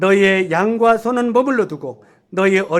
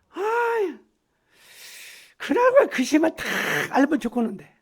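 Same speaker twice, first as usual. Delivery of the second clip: wishful whine; 7 semitones higher, 3 dB quieter than usual. Retold or imitated. Mimicking brother's wishful whine.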